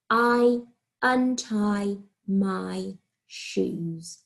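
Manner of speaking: words stretched out very slowly; slow dictation. Shown as a demonstration. Teacher slowly dictating a sentence to year one. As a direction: dictate each word slowly and clearly. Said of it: "teacher dictates slowly".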